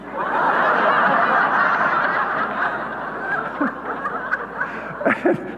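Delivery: high burst of laughter; big laugh. Real, not acted. Laughing at the mother's blunt line, high burst.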